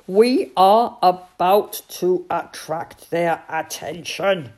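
loud, deliberate announcement. A narrator's reading. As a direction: proclaim it for a deaf listener. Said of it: "in a loud voice".

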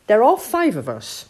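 loud, slightly high captain voice; confident captain. Acted. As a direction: reassure the crew firmly. Captain Beaky agrees confidently.

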